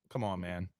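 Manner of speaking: low, soft grumble; muttered. Real, not acted. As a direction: grumble it under your breath. Complaint about name spelling.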